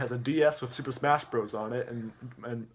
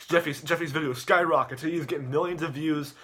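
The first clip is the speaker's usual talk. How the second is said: normal talking voice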